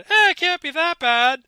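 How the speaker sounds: high, loud, cartoonish scoff; mock dismissive. Mock villain shrugging off danger.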